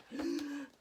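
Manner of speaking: lively, sing-song picture book read; reading to children. A narrator's reading. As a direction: read it like a bedtime story for kids. Reads picture book aloud.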